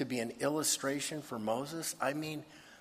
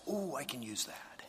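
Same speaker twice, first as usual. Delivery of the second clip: breathy whispered "oh" of interest; soft, intrigued half-whisper; hushed reply; 8 dB quieter than usual. Retold or imitated. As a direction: say it under your breath, intrigued. God's delighted reply, voiced by preacher.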